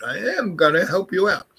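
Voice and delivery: lightly posh King's English. Retold or imitated. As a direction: put on a proper King's English accent. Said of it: "he speaks like the King's English".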